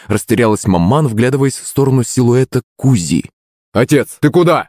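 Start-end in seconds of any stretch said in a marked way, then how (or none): 3.7-4.7 raised, higher calling voice; shout. Acted. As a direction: call out after him. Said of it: "крикнул батя".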